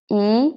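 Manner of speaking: I as "ee", rising; second tone. Shown as a demonstration. Teacher drilling fourth line, i rising. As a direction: slide I ("ee") upward. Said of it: "And the fourth line".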